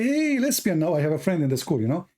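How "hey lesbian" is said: high friendly call across the cafeteria. Retold or imitated. Greeting the girl across the cafeteria, high call. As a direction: shout a cheery greeting. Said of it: "And I say".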